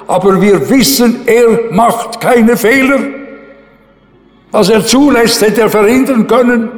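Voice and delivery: fervent preaching. Acted. Pastor telling his congregation God makes no mistakes, fervent preaching. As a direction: preach it with fire and conviction. Preacher on God's unsearchable judgments.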